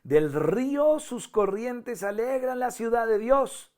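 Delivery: high, loud, stretched-out proclamation; voz alta. Shown as a demonstration. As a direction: read it as the high choir part, drawn out and ringing. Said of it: "Entonces vienen las altas". (voz alta, aguda y alargada)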